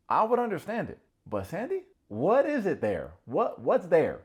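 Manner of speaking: high, loud disbelief; can't believe what he sees; exasperated rant. Real, not acted. Vlogger ranting at the screen about Sandy, high and loud.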